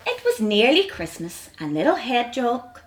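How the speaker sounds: ordinary delivery, nothing marked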